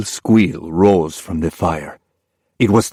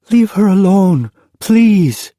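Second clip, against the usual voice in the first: put-on high, pleading child's voice; young girl begging; 14 semitones higher, 5 dB louder, slower than usual. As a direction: beg him to leave her mother be. Girl pleads with wolf for mother.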